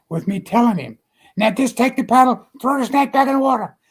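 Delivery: climbing high and loud, worked up; agitated retelling. Retold or imitated. [name] reliving his warning to Jerry, loud and high. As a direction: relive the shouted warning, rising.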